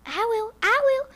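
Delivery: the pig volunteers in a high squeal; squeaky pig. Acted. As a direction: squeal it out like a piglet. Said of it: "said the pig".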